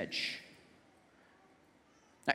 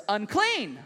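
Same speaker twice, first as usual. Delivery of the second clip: raised, higher warning cry; acted leper's public call; yelling out; 7 semitones higher, 3 dB louder, slower than usual. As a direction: call out a repeated warning to passers-by. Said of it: "yelling out the words".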